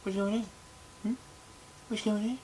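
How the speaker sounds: high, soft, airy little cat voice; a cat greeting you in a tiny high voice; voicing the cat. Cat voiced by its owner, greeting him in a tiny high voice. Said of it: "He's like".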